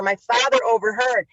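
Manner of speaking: ordinary talk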